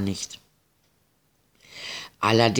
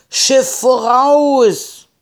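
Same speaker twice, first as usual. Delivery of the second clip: long, high, loud lookout cry; calling out; 13 semitones higher, 7 dB louder, much slower than usual. A narrator's reading. Pirate lookout spots a ship, calling out loudly. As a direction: shout it across the deck, drawn out. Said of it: "bis einer rief".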